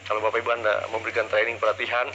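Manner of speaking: low, listless trainer voice; deliberately lifeless call for enthusiasm; mock unenthusiastic. Shown as a demonstration. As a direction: sag into a sluggish, fading delivery.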